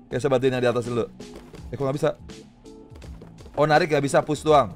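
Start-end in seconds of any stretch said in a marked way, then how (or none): none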